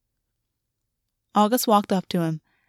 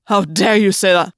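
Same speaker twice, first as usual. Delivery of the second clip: loud, indignant demand; outraged; 6 dB louder than usual. Acted. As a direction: throw it back, offended and loud. Gwen outraged at insult.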